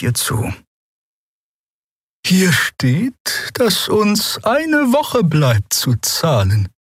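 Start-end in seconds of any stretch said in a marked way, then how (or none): 2.3-6.7 high, slow, gentle voice; gently. A narrator's reading. Xea reads the tax letter to calm the two, gently. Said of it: "sagte die Draxyl sanft".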